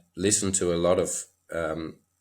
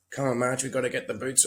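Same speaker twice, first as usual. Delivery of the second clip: higher-pitched, hurried-along nagging under stress; 6 semitones higher, faster than usual. Retold or imitated. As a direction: re-enact your own pre-ride fluster. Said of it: "like, all the stress".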